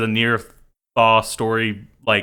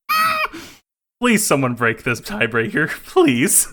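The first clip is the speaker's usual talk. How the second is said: pleading; 7 semitones higher than usual. Retold or imitated. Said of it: "and I'm like".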